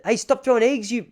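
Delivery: put-on high-pitched protest in an unsure Lebanese accent; attempted Lebanese accent. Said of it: "how does a Lebanese accent go?".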